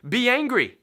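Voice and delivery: be angry, said high and firm; ANGRY punched hard as an order; emphatic command. Shown as a demonstration. Preacher pressing the point home, a firm command. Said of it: "Because it's telling you".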